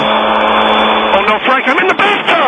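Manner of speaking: high yelled protest; yelling offstage. Acted. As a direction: call out to the next room. Caller yells at husband.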